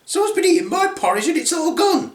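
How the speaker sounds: high-pitched little bear whine; Baby Bear. Acted. Little bear finding the porridge gone, upset. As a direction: complain in a high, childlike cub voice. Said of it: "said Baby Bear".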